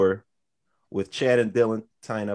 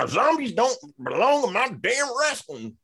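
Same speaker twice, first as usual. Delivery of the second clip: high, drawn-out mock outrage of an irate wrestling fan; Braun Strowman; 14 semitones higher than usual. Podcaster mocking angry fans about zombies, high irate put-on voice. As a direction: put on a strained, high complaining rant. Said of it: "Why is Braun Strowman, uh, complaining about the zombies?".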